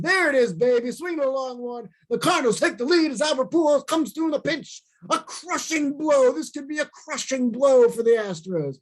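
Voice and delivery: high, excited radio call, building up; Shannon home run call. Retold or imitated. The speaker retelling Shannon's call of the Pujols homer, excited. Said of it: "He goes".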